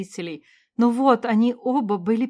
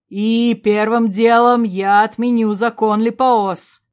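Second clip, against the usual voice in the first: slow, loud, resolute decree; emphatic, weighty announcement; exclaiming; 8 dB louder, slower than usual. A narrator's reading. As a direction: declare it like a king taking charge. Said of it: "воскликнул Дроссарин".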